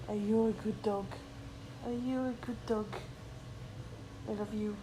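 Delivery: soft cooing to a dog; doting pet talk. Real, not acted. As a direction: fuss lovingly over your dog.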